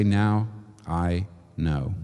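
quiet conviction. Acted. Climax quote of conviction.

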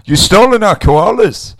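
loud, high mock Australian rant; hammed-up Australian accent. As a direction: shout it like a cartoon Aussie. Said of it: "We're still doing it again".

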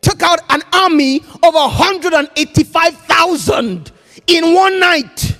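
high, loud climax; preaching shout. Real, not acted. Preacher telling of the angel who slew an army, shouting. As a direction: hammer the numbers home.

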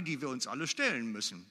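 ordinary delivery

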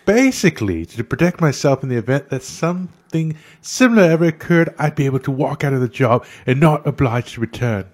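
mock Australian accent. Podcast host reads Rebel Wilson's tweet in an Australian accent. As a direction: read her tweets in a hammed-up Australian voice. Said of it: "try to read it in a British voice like Rebel Wilson".

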